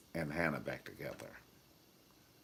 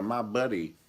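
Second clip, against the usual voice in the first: mock toy ad; 8 semitones higher, 3 dB louder, slower than usual. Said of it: "you be wanting to get a".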